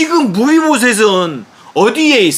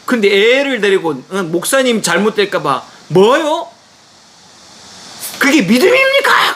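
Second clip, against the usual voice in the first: angry shout; 3 semitones higher than usual. Retold or imitated. Father yelling at the pastor down the phone, peaking shout. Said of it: "고함을 막 치니까".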